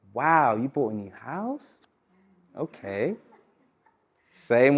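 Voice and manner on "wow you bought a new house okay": soft, slow, sceptical wow fading to a flat okay; doubtful, unconvinced, trailing off quietly; unimpressed wow. Shown as a demonstration. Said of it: "Or I could say".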